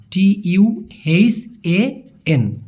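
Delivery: letters spelled out slowly; slow letter-by-letter spelling. Shown as a demonstration. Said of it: "আর একটা হচ্ছে তুহান".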